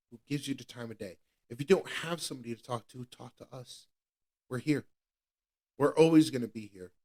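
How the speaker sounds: ordinary talk, nothing marked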